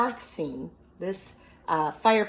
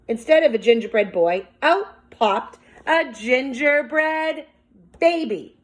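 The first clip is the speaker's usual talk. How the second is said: much higher, louder, slowing toward the surprise; building excitement; 8 semitones higher, 6 dB louder than usual. Acted. Teacher reading the oven-opening surprise to the class, high and excited. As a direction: stretch it out to the big reveal.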